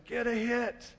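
high mock yell from the stands; imitated screaming. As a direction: cheer on like a screaming relative. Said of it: "screaming on one side".

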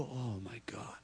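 whispered, stricken realization; hushed dismay. Acted. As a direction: whisper it as if it just sank in. Acting out waking up spiritually bankrupt.

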